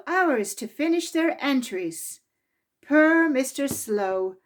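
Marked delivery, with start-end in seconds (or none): none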